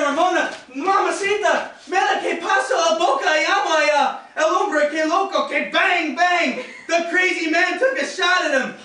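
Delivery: put-on high, frantic Spanish-speaking young man; Romero. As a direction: imitate a panicked report, sliding from Spanish into English. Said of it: "he tells Rosita".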